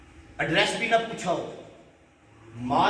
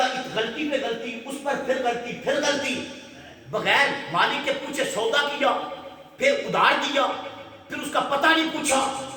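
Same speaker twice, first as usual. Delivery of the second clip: higher, rising furious rant; angry scolding; 6 semitones higher than usual. Acted. Owner berating his servants, angry and building. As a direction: pile up the blame, building. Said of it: "اور زیادہ غصے میں آ گیا".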